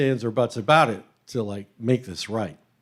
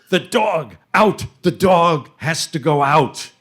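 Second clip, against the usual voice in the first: put-on loud, higher, slower furious outburst with a foreign accent; angry spluttering chef; 4 semitones higher, 7 dB louder, slower than usual. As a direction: bark the order at the customer. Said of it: "He is spluttering".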